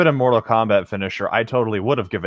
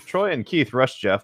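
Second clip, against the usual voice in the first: ordinary talk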